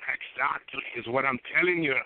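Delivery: put-on Irish leprechaun brogue; mock Irish accent, slowing on "We love gold". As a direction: play a gold-loving old Irishman. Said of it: "Irishman, I'm Irish".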